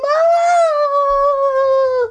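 shrill, loud scream of fright; high-pitched scream. A narrator's reading. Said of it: "makes you scream".

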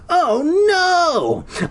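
very high mock wail; stretched, high theatrical cry; hammed-up panic. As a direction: cry out in exaggerated horror.

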